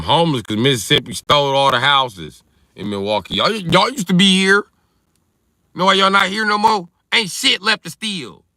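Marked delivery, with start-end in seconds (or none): 3.4-8.4 high, loud call-out to the departed; mock accusation. Comic calling out Mississippians who left Milwaukee, high loud accusation. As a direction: shout it up high like an accusing neighbor.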